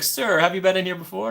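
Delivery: mock the staff catching her again; shop clerk. Retelling the Ghirardelli clerk, lightly mocking. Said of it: "they're like".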